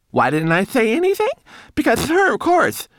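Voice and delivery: very high, loud little voice; indignant homunculus. A narrator's reading. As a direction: fling the accusation back, outraged. Accused homunculus answers indignantly.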